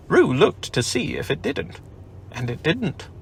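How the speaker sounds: usual speaking voice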